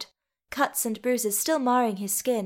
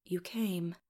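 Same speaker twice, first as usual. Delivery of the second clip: whispered from a sickbed; weak whisper; 2 semitones lower, 9 dB quieter than usual. A narrator's reading. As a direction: whisper it with little strength left. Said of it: "Thorin's voice was a whisper".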